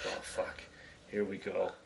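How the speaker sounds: breathy under-the-breath dread; whispered inner thought. Retold or imitated. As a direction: whisper it to yourself, bracing.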